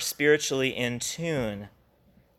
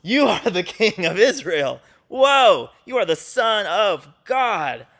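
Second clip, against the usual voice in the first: higher and louder, Whoa bursting up; awestruck exclamation; 5 semitones higher, 5 dB louder than usual. Retold or imitated. Nathanael meeting Jesus, awestruck and excited. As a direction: exclaim it in wonder. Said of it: "He's going".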